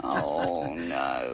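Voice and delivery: very low, hammed-up groaning "oh no"; deep mock dismay. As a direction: sink the voice down into a mock lament. Mock dismay at bad news.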